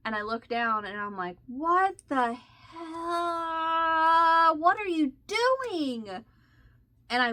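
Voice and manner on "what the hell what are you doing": high, stretched-out cry of dismay; shocked outburst. Retold or imitated. Woman finding tea dripping on her shawl, shocked high cry. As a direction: shriek it as you spot the ruined yarn. Discovering tea spilled on yarn.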